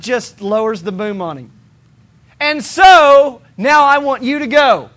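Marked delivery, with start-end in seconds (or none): 2.4-4.9 booming command. Acted. God lowers the boom.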